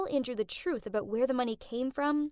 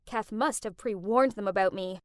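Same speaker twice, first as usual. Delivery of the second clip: ordinary delivery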